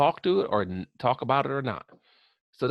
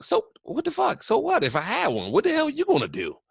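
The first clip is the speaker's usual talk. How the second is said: defensive barber; 10 semitones higher than usual. Retold or imitated. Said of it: "the barber got defensive".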